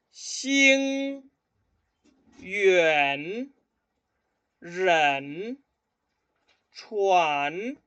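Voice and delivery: loud, carefully exaggerated tones, one word at a time; 跟我读的发音示范; repeat-after-me drill. Shown as a demonstration. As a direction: model each word for students to echo. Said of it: "escuchen y repitan después de mí".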